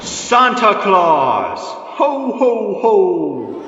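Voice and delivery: booming, drawn-out Santa laugh; jolly Santa. Acted. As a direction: belt out a big jolly ho ho ho. Santa bursts in announcing himself.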